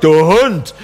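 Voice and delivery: HUND thrown high and falling, as an insult; demonstrated insult, pitch jumping up then dropping. Shown as a demonstration. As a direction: hurl "Du Hund" as a terrible insult. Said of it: "dann ist eine furchtbare Beleidigung".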